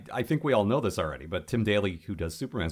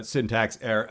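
glitching robot; 4 semitones higher, 5 dB louder than usual. Retold or imitated. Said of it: "picture Brainiac expl".

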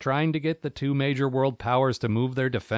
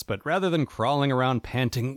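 ordinary talk

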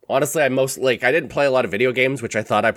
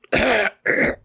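sick coughing; 9 semitones higher than usual. Pretend. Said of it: "it would have sounded like this".

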